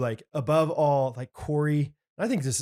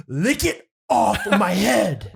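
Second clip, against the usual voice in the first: slow, higher, louder command; slow demanding counselor; 6 semitones higher, 6 dB louder, much slower than usual. Retold or imitated. Counselor ordering Kyle to lick off mayonnaise, slow mock command. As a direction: drag out every word like an absurd order. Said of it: "He goes".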